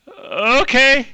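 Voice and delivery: loud okay sliding way up; weirded-out onlookers. Acted. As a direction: draw it out high, awkward and unsure. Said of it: "the people are like".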